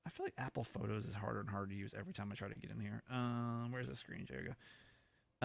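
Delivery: low, soft talking to oneself; absent-minded muttering. Real, not acted. Podcaster hunting through the phone for a screenshot, muttering low. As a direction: mutter half to yourself while searching.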